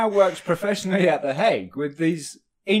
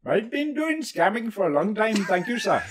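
put-on playful Indian-accented call-center scammer; 3 semitones higher than usual. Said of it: "I can do the Indian accent".

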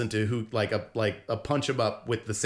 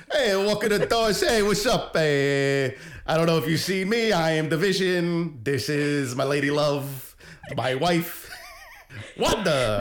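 put-on New Jersey accent; 2 semitones higher than usual. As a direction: play Vision as a chummy guy with a New Jersey accent. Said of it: "the little New Jersey accent going".

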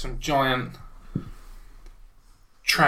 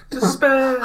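mock despair moan; 10 semitones higher than usual. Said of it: "he goes".

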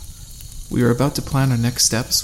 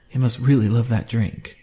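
ordinary delivery

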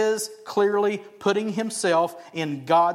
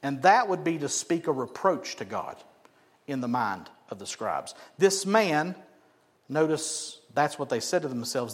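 ordinary delivery

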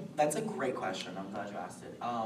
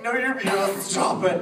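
loud, high starstruck protest; flustered gushing; 8 semitones higher, 12 dB louder than usual. Retold or imitated. Fan to voice actress she admires, flustered gushing. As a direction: bat the compliment back, loud and giddy. Retelling gushing compliments with an idol.

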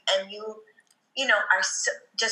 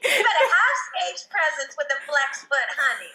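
sassy coach; 7 semitones higher than usual. Retold or imitated. Imitating sassy dance coach.

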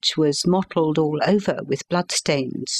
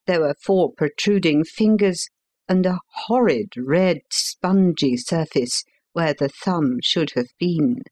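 ordinary delivery

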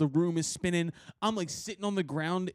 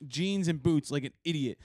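usual speaking voice

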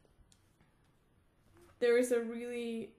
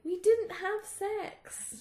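drawn-out excuse; 8 semitones higher, much slower than usual. Retold or imitated. Voicing Harry slowly.

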